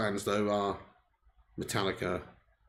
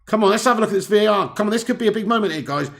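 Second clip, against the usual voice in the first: mock footballer plea; 6 semitones higher, 5 dB louder, faster than usual. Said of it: "McTominay's like".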